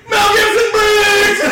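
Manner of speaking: high, slow panicked shout; shouting the name. Acted. Patient shouts out Briggs' name, high slow shout. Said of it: "He shouted out Briggs' name".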